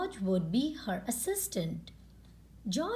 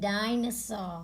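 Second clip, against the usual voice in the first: usual speaking voice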